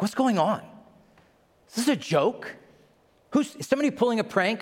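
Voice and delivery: panicked confusion, rushing the end; startled disbelief. Retold or imitated. Startled Hopkins character.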